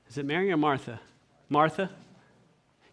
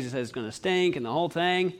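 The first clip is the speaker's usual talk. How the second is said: normal talking voice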